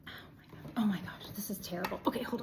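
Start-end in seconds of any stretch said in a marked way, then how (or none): 0.1-0.7 unvoiced, hushed whisper. Real, not acted. Reader struggling to sit on the floor, whispering. 1.0-2.0 soft unvoiced whisper. Real, not acted. Reader complaining about the hard floor, whispered.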